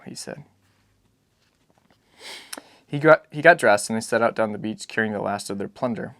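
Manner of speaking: ordinary delivery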